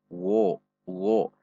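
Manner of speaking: "Wo, wo" with lips rounded, shown as the mistake; "wo" said with a round mouth as an error; wrong rounded wo. Shown as a demonstration. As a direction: round the lips too much on "Wo". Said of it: "tidak dengan membulatkan bibir".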